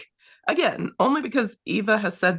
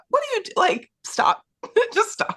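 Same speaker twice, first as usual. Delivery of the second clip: exasperated; 13 semitones higher than usual. Retold or imitated. Star exasperated at Eva.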